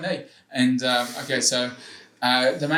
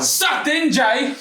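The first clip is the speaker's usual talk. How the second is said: high, loud taunting yell at Jay; gloating shout; 11 semitones higher, 5 dB louder, much slower than usual. Real, not acted. Host taunting Jay after winning the bet, gloating shout. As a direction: crow it in his face after winning.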